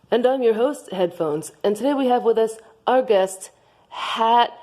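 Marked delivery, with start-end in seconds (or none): none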